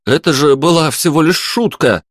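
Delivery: high-pitched protesting cry; pleading exclamation, pitched high; exclaiming. A narrator's reading. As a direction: exclaim it in self-defence. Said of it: "воскликнул Лёша".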